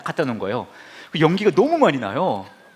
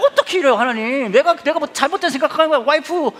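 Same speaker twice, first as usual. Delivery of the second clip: higher, louder, hammed-up protest to God; whining outburst; 13 semitones higher, 6 dB louder than usual. Pastor retelling his angry prayer to God, mock whining shout. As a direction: overact the hurt complaint. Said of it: "막 원망을 했죠".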